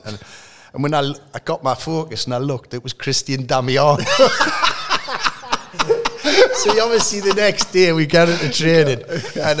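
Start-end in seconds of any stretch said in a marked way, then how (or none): 4.1-6.5 high, loud burst of real laughter; big real laugh at the story; hearty laugh. Real, not acted. Laughing at own taxi story. 6.5-9.6 laughing. Real, not acted. Laughing while telling story.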